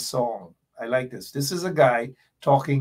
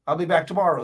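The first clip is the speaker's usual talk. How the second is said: ordinary talk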